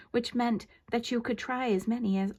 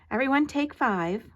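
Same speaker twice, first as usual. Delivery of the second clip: calling out; 6 semitones higher, 5 dB louder than usual. A narrator's reading. Director calling out to crew.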